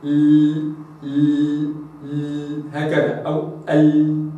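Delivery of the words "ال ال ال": ال repeated with no hamza before it; lam without a glottal stop. Shown as a demonstration. Teacher showing viewers ال without a hamza. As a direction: say ال straight from the tongue tip, no catch before it. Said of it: "أقول".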